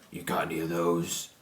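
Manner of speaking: gruff villain voice. Acted. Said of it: "And he's like".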